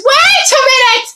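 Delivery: sharp high startled cry. Acted. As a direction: yell it out, high and sudden. Startled presenter cut off on air.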